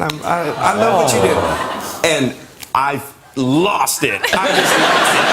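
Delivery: words breaking up with laughter; laughing. Real, not acted. Teller cracking up while recounting the call. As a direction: laugh through it, slowing down.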